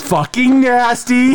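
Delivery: high, drawn-out disgust. Real, not acted. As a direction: stretch it out, grossed out. Disgust at chip pick again.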